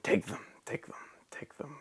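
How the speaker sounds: whispered, fading echo; cave echo. A narrator's reading. Echo answering the duck from the cave, whispered. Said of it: "came back from a welcome sound".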